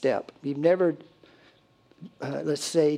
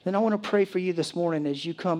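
ordinary talk, nothing marked

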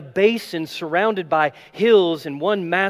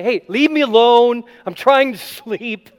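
louder, higher irritated snap; grumpy brush-off; 7 semitones higher, 8 dB louder than usual. Pretend. Imagined Jesus telling disciples to let him sleep, irritated. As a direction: shoo them away, annoyed at being woken. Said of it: "he doesn't say".

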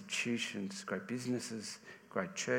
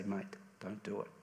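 softer, low-key appeal to a mate; gentle plea; 3 semitones lower, 10 dB quieter than usual. Retold or imitated. Urging a mate not to ride with a drunk driver, softly. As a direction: quietly talk a friend out of it. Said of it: "put your hand on your friend's shoulder and say".

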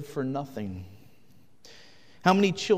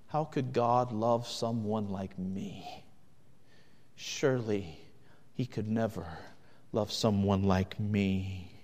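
slow, soft and lower, hopeless; despairing self-doubt; 5 semitones lower, 5 dB quieter than usual. Acted. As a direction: murmur it like someone crushed by guilt. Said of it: "you become paralyzed by past sins".

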